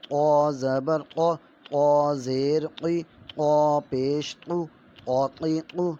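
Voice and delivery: slow, loud sing-song recitation of letter sounds; syllable drill. Shown as a demonstration. Teacher drilling children in Urdu letter sounds, slow and loud. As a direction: chant each syllable slowly like a classroom primer.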